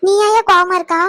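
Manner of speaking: loud, pressing question; demanding question. Acted. Mother demands why he is angry. (குரல் உயர்த்திக் கேட்பது)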